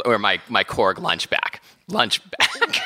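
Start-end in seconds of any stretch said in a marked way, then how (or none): 1.9-2.9 said through a laugh, higher; giggling on the words. Real, not acted. Repeating own word, amused.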